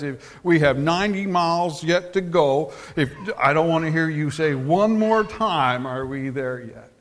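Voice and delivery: loud, emphatic scolding; fed-up mom. Acted. Mom to her four-year-old son after one too many questions, scolding. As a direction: lay down the law loudly, out of patience.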